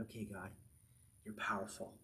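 low, hushed musing; quiet, pondering prayer with pauses; meditative self-talk. Acted. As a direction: think it over softly to God. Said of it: "he's meditating upon it".

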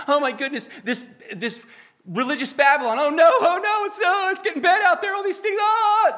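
high, loud, frantic hand-wringing wail; mock panic. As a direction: ham up a panicky doomsayer. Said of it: "are we supposed to be acting like that".

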